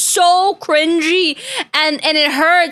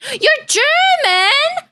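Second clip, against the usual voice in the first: surprised; 12 semitones higher, much slower than usual. Real, not acted. Reacting to chat message.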